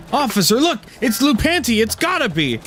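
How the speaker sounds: high-pitched, urgent alert; alarmed accuser. Acted. Bystander points out Lou Panty to officer, alarmed. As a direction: call the officer over in a high voice.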